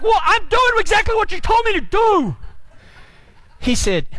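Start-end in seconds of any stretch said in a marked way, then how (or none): 0.0-2.4 high-pitched exasperated comeback; exasperated protest. Retold or imitated. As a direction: protest indignantly, pitch climbing. Arguing back at his instructor.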